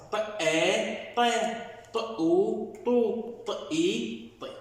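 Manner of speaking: ط joined with each vowel: طا، طو، طي; letter sound drill. Shown as a demonstration. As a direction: sound out ط with آ، أو، إي in turn. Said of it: "صادقنا مع حروف المد". (تهجئة الطاء مع حروف المد)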